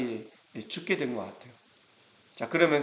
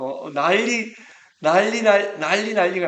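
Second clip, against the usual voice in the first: higher, louder, building excitement; excited exclaim; 7 semitones higher, 8 dB louder than usual. Real, not acted. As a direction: burst out with the uproar. Crowd astonished at the miracle. (기적에 놀라 흥분해서 높고 크게 외치는 말투)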